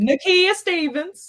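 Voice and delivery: high, loud, drawn-out announcement; calling out a name. Real, not acted. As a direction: proclaim the name slowly and proudly.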